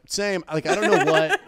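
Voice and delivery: high, loud laughing. Real, not acted. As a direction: laugh through the words. Laughing at own answer.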